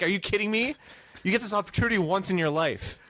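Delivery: high-pitched retold scolding; mock-appalled, pitched well up; incredulous. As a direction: plead in comic disbelief.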